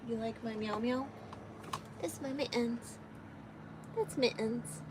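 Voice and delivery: normal talking voice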